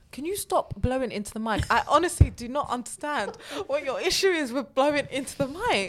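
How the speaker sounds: exasperated telling-off. Real, not acted. Scolding friend for blowing mic.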